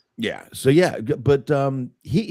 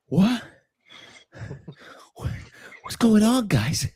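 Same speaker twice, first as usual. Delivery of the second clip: much higher, confused mock of a winded actor; winded Wahlberg; 14 semitones higher than usual. Mocking Mark Wahlberg's breathless acting, high confused voice. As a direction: ham up a breathless dazed guy. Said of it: "he's always out of breath".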